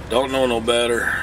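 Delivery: normal talking voice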